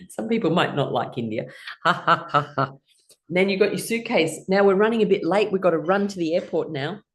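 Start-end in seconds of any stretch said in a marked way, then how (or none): 1.8-2.7 deliberate spaced ha-has; forced ha-ha-ha; exercise laughter. Shown as a demonstration. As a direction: laugh in four even beats.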